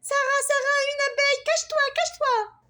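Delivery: put-on high, loud panicked child's cry; frightened. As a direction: scream a warning about the bee. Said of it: "Sophia effrayée". (petite fille effrayée, cri aigu et fort)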